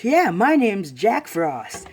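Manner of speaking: loud, high, drawn-out boast; showy self-introduction. Acted. Jack Frost floating in announcing himself, showy. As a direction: announce yourself with swagger.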